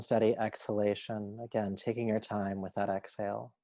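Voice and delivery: measured yoga nidra guide voice; unhurried, steady meditation instruction; guided meditation. Acted. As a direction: guide them calmly, a phrase at a time with long pauses.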